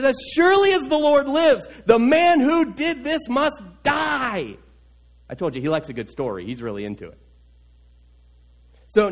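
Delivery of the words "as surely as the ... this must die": furious verdict. Acted. King David swearing the rich man must die, loud and furious. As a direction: pronounce the death sentence in fury.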